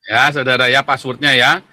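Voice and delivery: ordinary talk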